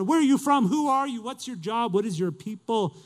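high-pitched, urgent acted interrogation; desperate questioning. Desperate sailors to Jonah, high urgent questioning. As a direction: fire questions at a stranger in alarm.

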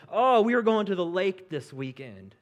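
mock selfish excuse. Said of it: "more important than".

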